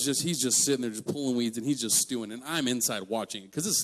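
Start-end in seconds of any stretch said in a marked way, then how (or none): none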